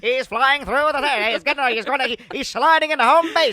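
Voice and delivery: high, loud vintage play-by-play; old radio sportscaster. Pretend. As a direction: call it like a radio announcer. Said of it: "sports radio".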